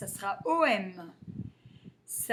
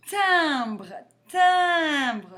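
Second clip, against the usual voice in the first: "timbre" said louder and higher, vowel drawn out; sounding out timbre; 6 semitones higher, 8 dB louder than usual. Shown as a demonstration. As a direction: stress the "im" of "timbre" for pupils. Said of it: "c'est le son un".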